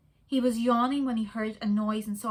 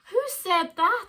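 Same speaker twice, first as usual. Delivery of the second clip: put-on girl's voice; 9 semitones higher, 6 dB louder, slower than usual. A narrator's reading. Gloria startled.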